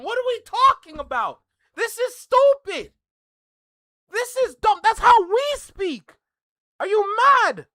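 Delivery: loud, high-pitched indignant rant; exasperated outburst. Real, not acted. Streamer defending how Toronto speaks, exasperated and loud. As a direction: raise your voice in real disbelief.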